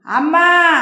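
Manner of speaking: loud, shocked cry of protest. Acted. Daughter crying out at her mother's insult, loud.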